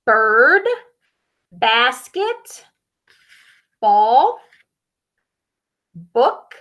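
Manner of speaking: slow, clear word-by-word reading; name each word for children; teaching word list. Shown as a demonstration. Reader teaches children B words, clear and slow. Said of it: "teaching B words".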